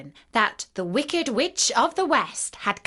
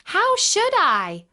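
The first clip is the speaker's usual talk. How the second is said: put-on high, slow, louder girlish protest; little girl; 11 semitones higher, 5 dB louder, slower than usual. A narrator's reading. Dorothy answering the melting witch, girlish protest. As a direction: plead innocence as a little girl. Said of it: "answered Dorothy".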